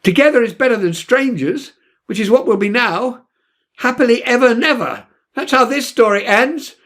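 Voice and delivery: high, bitter, angry outcry; hurt anger at the empty sea; rising rage. Acted. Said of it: "I felt my voice growing louder".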